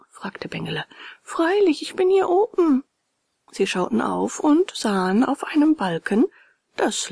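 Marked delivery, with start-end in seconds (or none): 1.2-2.8 high, bright-pitched call from above; high small-creature voice; little cricket voice. A narrator's reading. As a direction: pipe up in a tiny high voice. Tiny cricket calls from beam.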